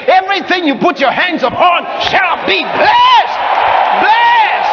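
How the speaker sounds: rising to a high, ringing shout; proclaiming. Real, not acted. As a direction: preach it up to a climactic cry. Preacher proclaiming the blessing, climactic.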